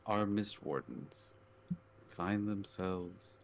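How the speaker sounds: slow, measured scene setting; game master setting the scene; scene narration. Acted. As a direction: paint the cold mountain for the table.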